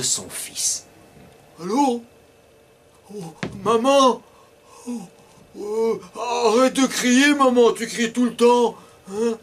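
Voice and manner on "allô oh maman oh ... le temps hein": put-on higher, childlike boy on the phone; boy's voice. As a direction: play the cheeky schoolboy, higher. Romain voices himself on phone.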